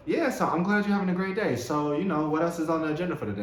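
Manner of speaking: pry brightly into their plans; over-cheerful quick questions; chirpy probing. Acted. Acting pushy cheerful person.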